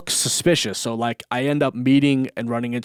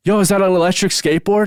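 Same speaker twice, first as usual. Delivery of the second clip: loud, quick call from a passing car, pitched up; cop calling out; 8 semitones higher, 7 dB louder, faster than usual. Retold or imitated. Cop yelling out his car window at the skater, loud and quick. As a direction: shout it across the street to a kid on a skateboard.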